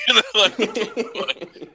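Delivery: real laugh breaking the words. Real, not acted. Mocking old-fashioned hipsters with a friend, laughing. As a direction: giggle through the jab.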